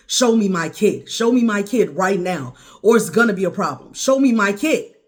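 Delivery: loud, insistent angry demand; forceful demand. Retold or imitated. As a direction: demand it loudly, punching each word. Retold demand to see her son.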